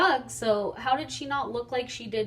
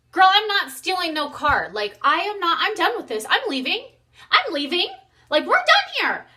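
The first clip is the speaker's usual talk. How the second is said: higher and louder, fed-up snap; snapping at her; 9 semitones higher, 7 dB louder than usual. Retold or imitated. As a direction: tell her off, you're done with this. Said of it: "I snapped".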